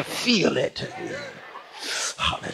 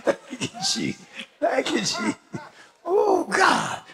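laughing praise; 9 semitones higher than usual. Real, not acted. Overcome, laughing then crying.

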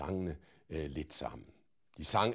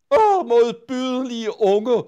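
very loud, high, slow indignant shout; shouted after her; 16 dB louder, slower than usual. A narrator's reading. To Pippi walking away, a loud outraged shout. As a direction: cry out in outrage at the child. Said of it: "råbte fru Finkvist efter hende".

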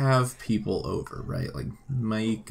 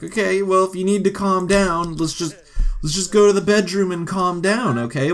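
pitched up and loud, soothing a partner; high, loud coaxing sim voice; 7 semitones higher, 5 dB louder than usual. Pretend. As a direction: loudly talk her down in a raised voice.

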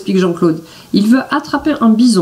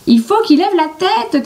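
much higher shouted line; shouting; 12 semitones higher than usual. A narrator's reading. Billy shouting from the tree to Jean-Claude. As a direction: yell it down from the tree. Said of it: "crie Billy".

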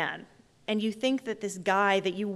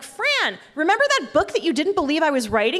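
high, loud, hurried retold boast; mock-triumphant call to a parent; excited announcement; 7 semitones higher, 6 dB louder than usual. Daughter announces her book to her mother, excited.

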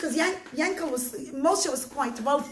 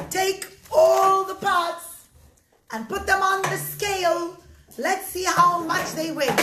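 commanding judge; 4 semitones higher, 5 dB louder than usual. Acted. Judge orders the pots weighed, commanding. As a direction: proclaim the order, higher and stretched.

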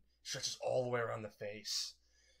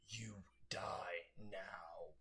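very slow voiceless monster whisper; chilling whisper; 3 semitones lower, 7 dB quieter, much slower than usual. Acted. Monster with hand through All Might's ribs, chilling whisper. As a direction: let each word drip like a death sentence.

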